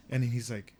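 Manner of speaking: ordinary talk, nothing marked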